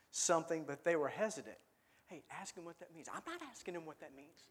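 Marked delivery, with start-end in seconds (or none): 2.1-3.1 whispered nudge between disciples; quietly urging a friend to ask; hushed aside. Retold or imitated. Disciple nudging another to ask Jesus, hushed. 3.1-4.4 whispered, quick refusal; hushed refusal. Retold or imitated. Disciple refusing his friend in a hushed voice.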